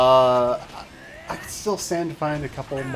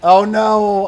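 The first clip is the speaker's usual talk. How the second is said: dismayed; 7 semitones higher, 11 dB louder than usual. Real, not acted. Realizes recording space is gone.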